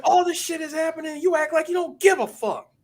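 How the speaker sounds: high-pitched, hammed-up indignant quote; retold angry outburst. Acting out a movie character's outburst, indignant. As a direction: act out an exasperated accusation.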